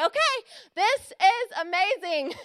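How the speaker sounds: high, exaggerated cheerful okay, then drawn-out delight; hammed-up upbeat acceptance, slowing down; acted eager agreement. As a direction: play up willing enthusiasm. Acts out eager obedience to God.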